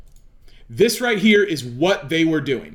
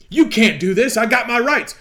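loud, higher, defiant citizen insisting on his rights; indignant protest; 5 semitones higher, 4 dB louder than usual. Acted. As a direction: push back hard, louder and higher. Acted citizen protesting to official.

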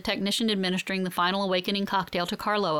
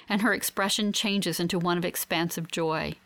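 ordinary delivery

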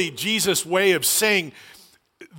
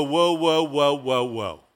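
string of whoas sliding steadily lower; mock balking; 5 semitones lower, slower than usual. Believer told to go to the earth's ends, mock alarmed balking. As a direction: put the brakes on, sinking in pitch. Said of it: "and you go".

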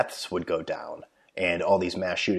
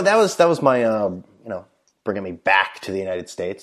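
usual speaking voice

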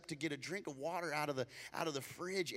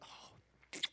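contented sigh; 16 dB quieter than usual. Retold or imitated. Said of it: "You're like".

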